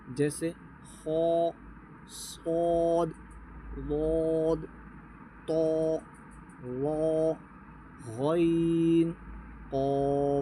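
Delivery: each heavy letter held long and thick; heavy Arabic articulation of the letters. Shown as a demonstration. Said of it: "ان کو جب ہم موٹا پڑھیں گے".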